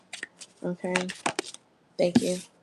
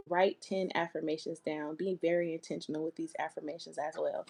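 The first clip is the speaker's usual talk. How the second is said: much softer, gently dropping level; soft guidance; 9 dB quieter than usual. Real, not acted. Reader softly advising client on affirmations. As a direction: ease into a near-hushed caring advice.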